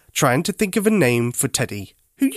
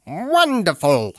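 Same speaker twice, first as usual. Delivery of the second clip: slow, higher duck voice; Duck's delighted voice; 4 semitones higher, slower than usual. A narrator's reading. Duck thanking Teddy for finding the jigsaw piece, slow and pleased. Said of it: "said Duck".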